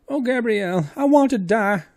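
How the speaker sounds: high, loud, despairing wail; terrified woman crying out; wailing frightened maid. Acted. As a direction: moan it with eyes shut tight. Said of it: "cried the poor woman".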